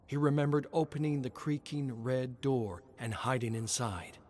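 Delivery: ordinary delivery, nothing marked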